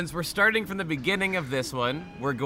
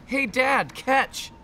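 calling out; 10 semitones higher, 3 dB louder, much slower than usual. Acted. Reading kid's call aloud.

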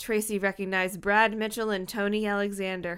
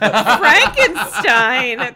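hammed-up questioning shout; 14 dB louder, much slower than usual. Reader exaggerates the puzzled shout.

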